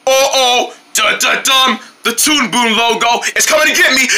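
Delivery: ordinary delivery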